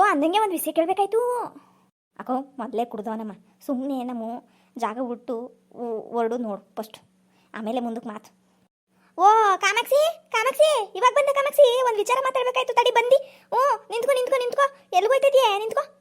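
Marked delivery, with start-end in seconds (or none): 2.2-8.4 low, hushed aside to a neighbour; quiet aside. Acted. Aside about the drunk man. 9.2-16.0 high, loud calls after a woman walking off; calling out. Acted. Drunk man calls after Kamakshi.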